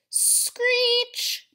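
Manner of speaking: very high, loud screech. A narrator's reading. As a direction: imitate the toaster scraping. Toaster noise imitated.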